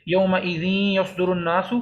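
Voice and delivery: slow یومئذ یصدر, n merged into nasal y; idgham with ghunnah. Shown as a demonstration. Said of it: "ہم ملا کے پڑھیں گے". (یومئذ یصدر کو ملا کر، ٹھہر کر غنہ سے پڑھیں)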